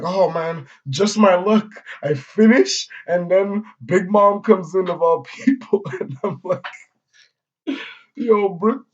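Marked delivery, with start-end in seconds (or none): 0.0-5.8 loud, high-pitched imitated complaint; mock exasperation. Brook telling the crew how he got caught, mock exasperation. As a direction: bemoan it loudly, hammed up. Brook bemoans his bad luck.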